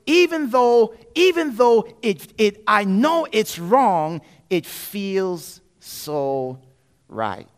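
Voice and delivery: lightly mocked infatuation; lovesick excuse. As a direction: linger on it like you're swooning. Acts a smitten believer.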